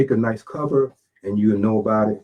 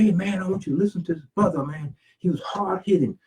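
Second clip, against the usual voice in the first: higher, quick fan recommendation; enthusiastic fan; 7 semitones higher than usual. Acted. Fan telling a friend about this speaker, lightly acted enthusiasm. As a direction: lightly act a fan tipping off a buddy.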